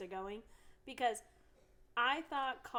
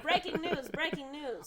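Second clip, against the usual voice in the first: breaking news chant, louder and higher; announcer voice; 7 semitones higher, 6 dB louder than usual. Pretend. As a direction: announce it like a newscaster. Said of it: "playful news announcement".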